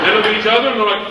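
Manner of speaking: usual speaking voice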